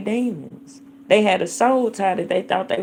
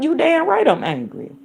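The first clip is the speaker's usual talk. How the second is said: angry; 7 semitones higher than usual. Real, not acted.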